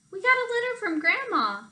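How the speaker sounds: put-on high, eager child announcing news; bursting with the news of a letter; children rushing in with Grandma's letter, high and eager; excited child. A narrator's reading. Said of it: "one of them said".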